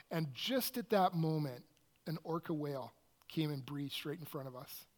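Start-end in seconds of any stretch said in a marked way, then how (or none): none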